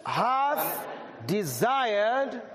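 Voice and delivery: very slow, "Satan" stretched high with a little laugh; slow for repeating. Shown as a demonstration. Preacher leading the church to say the verse, very slow. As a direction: spell it out word by word for them to echo. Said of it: "Can we say those words?".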